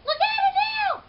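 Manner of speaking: very high, loud squealing shout; frantic squeaking. A narrator's reading. Hamster frantically squealing at the janitor, very high and loud. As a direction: shriek it, frantic and demanding. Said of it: "I was squeaking a blue streak".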